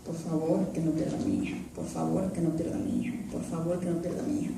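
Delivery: soft, tearful plea to God, fading to a near whisper; praying and crying. Retold or imitated. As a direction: beg God quietly through tears. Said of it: "venía orando y llorando". (súplica llorosa y suave, casi susurrada)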